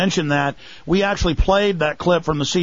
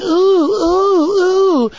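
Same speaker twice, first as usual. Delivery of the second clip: much higher, louder hammed-up cooing; mock thrilled oohs; 13 semitones higher, 5 dB louder, much slower than usual. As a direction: ape the crowd gasping in delight. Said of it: "they were all going".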